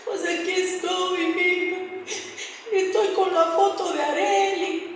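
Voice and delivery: plaintive, tearful sing-song; imitating grieving mother. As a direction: imitate a mother talking through tears. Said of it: "estaba en un momento de dolor".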